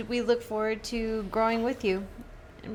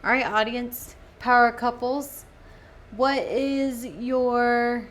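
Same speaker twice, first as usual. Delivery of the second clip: host announcement; 4 semitones higher, 7 dB louder, slower than usual. Acted. Addressing the podcast audience.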